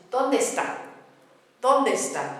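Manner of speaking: ¿dónde está? with the e sounds running together; ¿dónde está? stressed on the final syllable; vowels linked. Shown as a demonstration. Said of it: "running together".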